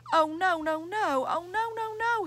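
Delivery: high, anguished wail of dismay; groaned. Acted. Jeff on the floor after slipping, despairing groan. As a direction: moan it, despairing at himself. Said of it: "he groaned".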